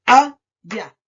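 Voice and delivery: "allá" as a loud A, then llá; syllable by syllable. Shown as a demonstration. Teacher counting syllables, "allá" split loudly in two. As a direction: break "allá" into two slow, forceful beats. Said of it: "Tiene dos sílabas".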